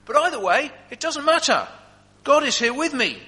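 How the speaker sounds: high and loud, full of faith; Paul tells the guard he fears nothing; joyful Paul. Acted. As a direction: declare it with fervent joy. Paul's joyful defiance in chains.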